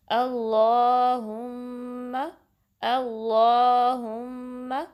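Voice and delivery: अल्लाहुम्मा very slow, nasal hum held on the मीम; slow recitation with nasal ghunna; ghunna on meem. Shown as a demonstration. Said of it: "मीम पे गुन्ना का time देना है".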